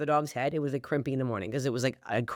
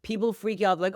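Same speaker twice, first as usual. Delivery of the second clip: ordinary talk, nothing marked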